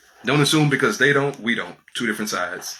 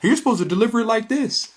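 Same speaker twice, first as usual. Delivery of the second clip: high-pitched mocking scold; high, mocking voice policing how to talk; mock cult enforcer; 11 semitones higher than usual. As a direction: mimic a cult member correcting everyone. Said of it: "that cult focuses on doing".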